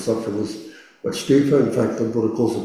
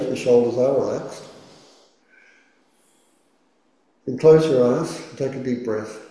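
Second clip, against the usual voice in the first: guided meditation. Acted. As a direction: gently guide the listener into stillness. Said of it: "let's do our first meditation".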